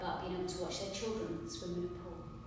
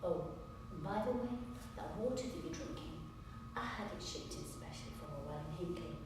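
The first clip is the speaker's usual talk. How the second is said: quiet parting jab; 4 semitones lower, 14 dB quieter than usual. Acted. Lawyer to opposing side after rejecting their offer, quiet parting jab. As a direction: drop to a much softer voice, understated and pointed.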